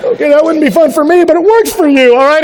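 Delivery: high, loud joking aside; playful teasing. Retold or imitated. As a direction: tease them lightly. Teasing reply.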